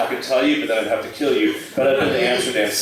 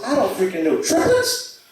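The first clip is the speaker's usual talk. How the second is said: louder, higher, fed-up outburst; exasperated; 11 semitones higher, 4 dB louder than usual. Retold or imitated. Mugged friend answering the police, exasperated. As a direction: throw the question back in frustration. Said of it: "threw his hands up and goes".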